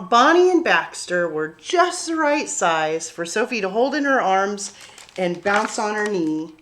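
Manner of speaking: ordinary delivery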